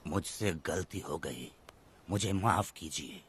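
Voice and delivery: slow, low, forced apology; grudging apology. Acted. Offender made to apologise before villagers, slow and reluctant. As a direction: drag out each word, pitched well down.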